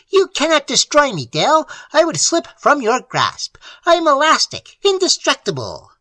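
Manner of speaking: high, loud shrill imp voice; shrill triumphant boasting; shrill little demon. Acted. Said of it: "The little shape shrilled".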